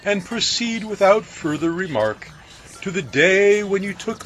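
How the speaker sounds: earnest storyteller figure; baker's voice. A narrator's reading.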